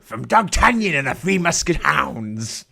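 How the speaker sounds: pirate voice. Pretend. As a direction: ham up a pirate announcer. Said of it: "a terrible impression of a pirate".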